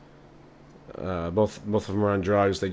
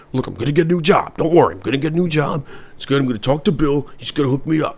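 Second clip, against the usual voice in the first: higher, louder pleading dad voice; laid-off dad; 7 semitones higher, 5 dB louder than usual. Acted. Jobless father reassuring his wife, higher and louder. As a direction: insist he'll get a new job, voice pitched up.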